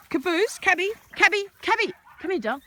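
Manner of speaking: high, loud sing-song dog call. Real, not acted. Calling dog sweetly.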